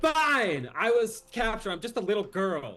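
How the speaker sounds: put-on sulky girl. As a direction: give in with a huff. Girl admitting sulkily.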